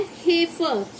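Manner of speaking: हेफर as HAY-fer; demonstrating pronunciation. Shown as a demonstration. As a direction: model हेफर with an ay vowel. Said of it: "ए से करते हैं".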